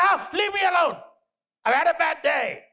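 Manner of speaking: high-pitched angry mother brushing off her son; acted irritated outburst. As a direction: retell it as an exasperated shout. Stressed mother snaps at eager son.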